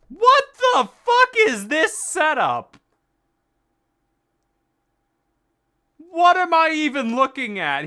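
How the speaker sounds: baffled, drag out each word; disbelieving outburst. Real, not acted. Sees the strange office.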